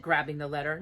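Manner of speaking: usual speaking voice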